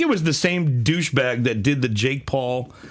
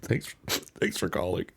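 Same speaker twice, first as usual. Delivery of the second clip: low, soft, halting voice; acted devastated; 6 semitones lower, 5 dB quieter than usual. Announcer hearing bad news about the dog, acted quiet grief. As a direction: trail off into a stunned near-whisper. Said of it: "he gets the call".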